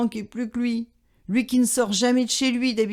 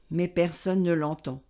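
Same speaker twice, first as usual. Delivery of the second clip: ordinary delivery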